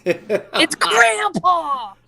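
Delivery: slow, drawn-out loud cry of "Grandpa"; excited announcement. Real, not acted. Host's friend loudly greets newcomer, excited and drawn-out. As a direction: stretch it out like a gleeful announcement.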